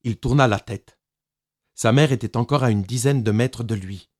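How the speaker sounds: ordinary delivery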